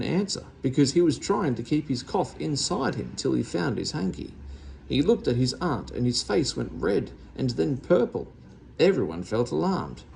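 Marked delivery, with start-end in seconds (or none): none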